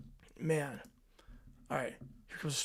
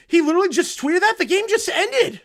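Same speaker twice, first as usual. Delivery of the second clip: much higher and louder, in stunned disbelief; can't believe he already tweeted; incredulous; 11 semitones higher, 11 dB louder than usual. Real, not acted. Streamer sees an instant post-game tweet.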